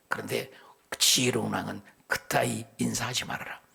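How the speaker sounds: usual speaking voice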